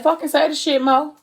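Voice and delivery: high-pitched, acted exasperation; frustrated demand. Man losing patience with Mo's hesitation, frustrated. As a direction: push it out in frustration, without shouting. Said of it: "the aggression and the frustration".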